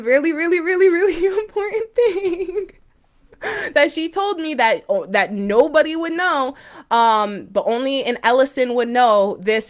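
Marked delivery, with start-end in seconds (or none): 0.0-4.8 higher, half-laughing, half-crying; real emotion breaking through a laugh; real laughter tipping into tears. Real, not acted. Emotional about the message.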